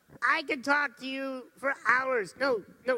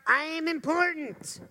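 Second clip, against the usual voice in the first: confident self-talk; 7 semitones higher, 4 dB louder than usual. Pretend. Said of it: "do you think to yourself".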